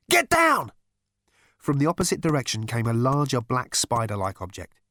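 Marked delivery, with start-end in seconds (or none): 0.1-0.7 very high, loud warning; urgent shout. Acted. Man warning Zaphod of a scout robot, urgent shout. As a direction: yell for them to take cover.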